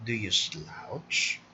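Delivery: low, slow slouchy mumble; a lazy slouching delivery; slouched, slumped voice. Shown as a demonstration. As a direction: sound like you're slouching.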